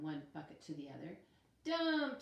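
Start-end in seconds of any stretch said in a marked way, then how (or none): 1.6-2.2 high, loud happy cry; excited baby word. A narrator's reading. Benjamin copying Frances in the sandbox, excited. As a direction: chirp it back eagerly.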